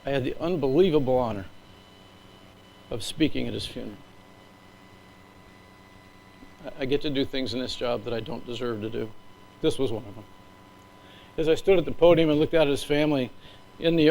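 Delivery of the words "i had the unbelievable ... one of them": real emotion held in check, halting with long pauses; choked up, mostly subdued, pausing to keep composure; getting emotional. Real, not acted. Speaker recalls the honor of speaking at his funeral, emotional. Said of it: "I'm gonna get emotional".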